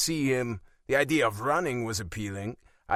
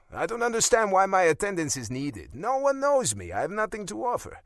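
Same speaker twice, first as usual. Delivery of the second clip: raised, high protest; 9 semitones higher, 4 dB louder than usual. A narrator's reading. Son arguing against attending the dinner, high protest. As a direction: push back, pitch climbing.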